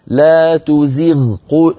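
تزغ held slow with the غ heavy, as al-Mutawalli reads it; demo recitation. Shown as a demonstration. Teacher demonstrating al-Mutawalli's reading of تزغ. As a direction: stretch لا تزغ slowly, غ thick. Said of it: "على كلام المتولي نقول هنا".

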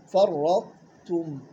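فرطتم slowly with the تاء crisp and full; tā clearly sounded. Shown as a demonstration. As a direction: articulate فرطتم, stretching it out. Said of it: "وين راحت التاء؟".

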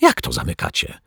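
ordinary delivery

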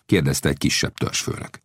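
ordinary delivery, nothing marked

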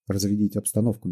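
ordinary delivery, nothing marked

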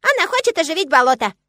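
high, loud squeaky squirrel; squirrel squeak. A narrator's reading. Squirrel blurts out the plan, shrill squeak. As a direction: pipe up in an excited squeal. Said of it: "раздался знакомый беличий писк".

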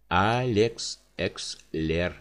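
very slow, spelled-out reading; syllable by syllable. Acted. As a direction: sound out each syllable slowly. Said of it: "по слогам прочитал".

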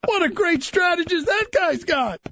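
high, hammed-up admiring gush; mock swooning fan. Host plays a fan charmed by the commissioner, high mock gush. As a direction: gush like a won-over fan. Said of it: "I was shaking my torch and a pitchfork".

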